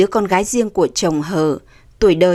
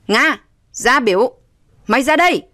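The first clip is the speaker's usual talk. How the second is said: raised, commanding call; angry father; 4 semitones higher than usual. A narrator's reading. Father at his daughter's door, angry. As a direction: demand she come out. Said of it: "Ông Cường nổi giận lôi đình lên".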